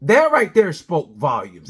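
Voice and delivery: ordinary delivery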